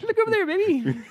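very high, loud, laughing call; play-acted shifty husband; mock distraction. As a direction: send someone looking away, barely holding the laugh. Shifty man distracting his wife.

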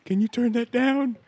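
high-pitched mock request; mock complaint. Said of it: "And you see how I'm like".

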